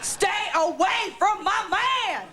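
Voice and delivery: high, slow, hammed-up yell with laughter; mock shouted threat. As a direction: bellow the warning at the rival. Talk-show guest shouting, laughing.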